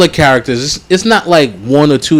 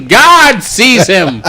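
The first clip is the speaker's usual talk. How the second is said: laughing slow pronouncement; 5 semitones higher, much slower than usual. Retold or imitated. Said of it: "one sentence away from saying".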